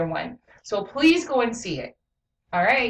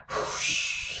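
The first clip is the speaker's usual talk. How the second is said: imitated swish of cloth; long breathy whoosh sound; 9 dB quieter than usual.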